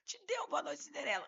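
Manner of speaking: incredulous echo. Retold or imitated. Lawyer echoing client's drugging story, acted incredulity. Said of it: "E eu desse jeito".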